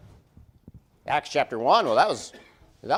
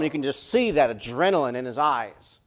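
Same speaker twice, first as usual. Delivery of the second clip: normal talking voice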